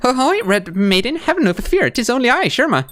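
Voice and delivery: high, slow, gentle little pilgrim voice; a small, kind bug greeting her rescuer; Sherma's cheery voice. Acted. Pilgrim Sherma greets the Red Maiden, high and gentle.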